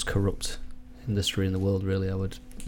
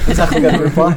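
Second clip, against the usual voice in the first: laughing; 8 semitones higher, 8 dB louder than usual. Real, not acted. Group cracking up at joke.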